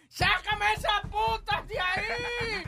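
slow, high furious shout; mock scream of a furious mother; angry mother yelling. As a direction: yell it like an enraged mom catching them. Mother yelling at smoking kids.

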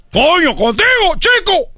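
slow, high-pitched scolding; exasperated father. Acted. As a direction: burst out in exasperation at the boy. Exasperated father scolding his son. (padre exasperado, lento y agudo)

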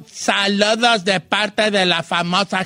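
ordinary talk

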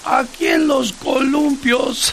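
put-on high, slow slurred drunk voice; town drunk. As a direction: drawl the punchline like a drunk. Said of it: "grita (the drunk answers)".

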